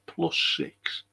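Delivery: breathy whispered disbelief; stunned whisper; disbelieving whisper. Real, not acted. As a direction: whisper it as if you can't believe it. Repeating the score in disbelief.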